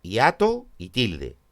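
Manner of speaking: normal talking voice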